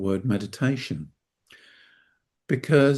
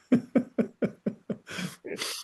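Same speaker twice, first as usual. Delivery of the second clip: breathy burst of laughter; helpless chuckling after a slip-up; 3 dB quieter than usual. Real, not acted. Guest tells host he's in now, laughing. Laughs at own screen mishap.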